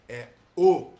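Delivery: "é" aberto, depois "o" fechado, bem alto; vowel contrast demo. Shown as a demonstration. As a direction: open é then closed o, stressed hard. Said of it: "O não é o". (contraste de vogais, bem alto)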